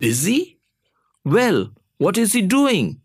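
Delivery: high, impatient questioning. A narrator's reading. Boss questioning child about policeman, impatient. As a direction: press for an answer, pitch jumping up. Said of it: "the boss impatiently inquired".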